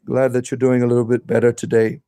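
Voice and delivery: ordinary talk, nothing marked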